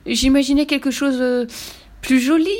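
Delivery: high, loud protest with a hesitation; panicked cry. A narrator's reading. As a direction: keep crying out, flustered. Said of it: "cria Lou affolé".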